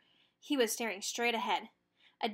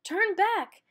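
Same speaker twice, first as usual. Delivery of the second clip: high, louder shout; yell; 9 semitones higher, 8 dB louder than usual. A narrator's reading. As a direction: call them back. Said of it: "skiers yelled".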